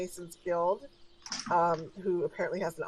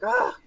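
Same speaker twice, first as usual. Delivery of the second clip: loud, breath-only huff; exasperated sigh; 5 dB louder than usual. Acted. Dismissed receptionist reacts to her boss, exasperated sigh. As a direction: let out a put-upon sigh.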